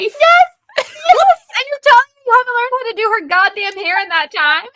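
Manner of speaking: loud, high, incredulous rant; real exasperation at the dad; outraged. Real, not acted.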